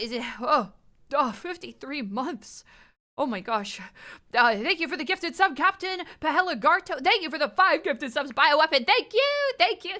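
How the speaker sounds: usual speaking voice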